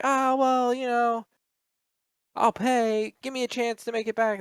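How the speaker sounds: high, loud whiny mock begging; pleading debtor. Mocking a debtor begging the repo man for a chance, high whiny plea. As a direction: whine like a caught debtor begging for time.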